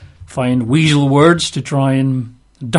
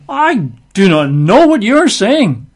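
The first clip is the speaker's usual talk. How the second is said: slow, higher-pitched emphatic denial; mock denial; 6 semitones higher, 3 dB louder, slower than usual. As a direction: deny it word by word, acted. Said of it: "he denied it before them all".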